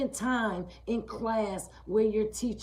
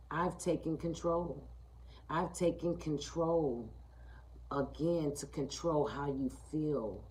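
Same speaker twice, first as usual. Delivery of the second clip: menacing low voice; 4 semitones lower, 7 dB quieter than usual. Acted. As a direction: speak as the voice that smothers you. Sermon voicing the controlling inner bully.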